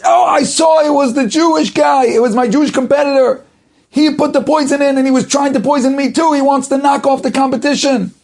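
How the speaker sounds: high, loud, rapid-fire accusation; loud accusing outcry. Retold or imitated. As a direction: shout it out in mock alarm, high and urgent. Acted false accusation by the competitor.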